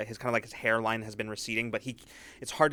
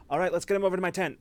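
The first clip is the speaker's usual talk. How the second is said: brisk medic; 8 semitones higher, much faster than usual. Acted. Medic takes charge of patient.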